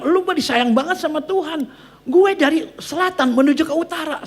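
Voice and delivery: high-pitched indignant grumbling; retold angry complaint. Mother complaining about her long trip north, acted annoyance. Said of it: "Kenapa dia marah-marah?".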